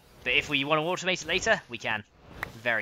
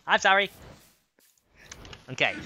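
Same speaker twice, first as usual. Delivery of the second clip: normal talking voice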